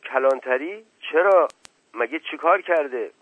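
high, loud astonished questions; taken aback, voice jumping up; startled questioning. Acted. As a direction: react in alarmed surprise to the news. Uncle startled by police news.

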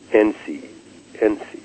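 gentle, hushed appeal to a friend; soft coaxing. Acted. Child to sulking friend, softly coaxing.

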